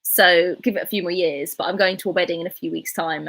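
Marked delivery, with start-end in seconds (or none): none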